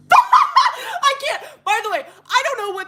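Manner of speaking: high, loud laughing shriek. Real, not acted. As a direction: crack up, can't go on.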